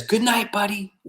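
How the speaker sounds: high, gentle bedtime voice; fond good night. Acted. Octopus bids his buddy good night, fond. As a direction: say it sweetly at bedtime.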